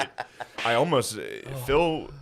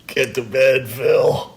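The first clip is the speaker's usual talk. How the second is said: mock command; 6 dB louder, much slower than usual. Mock order to his dad.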